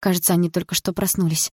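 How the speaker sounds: ordinary delivery, nothing marked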